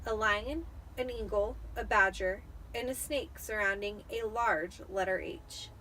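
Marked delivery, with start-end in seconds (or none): none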